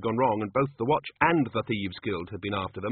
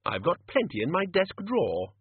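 higher-pitched bluff senior wizard; musing Ridcully; 8 semitones higher than usual. A narrator's reading. Ridcully muses about his desk supplies, higher bluff voice. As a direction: think aloud, lightly in character. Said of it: "mused Ridcully".

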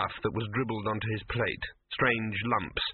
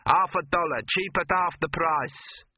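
leering cook, drawn out slow; 6 semitones higher, 4 dB louder, slower than usual. Acted. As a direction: leer at the boy as a greasy cook. Cook voiced in story.

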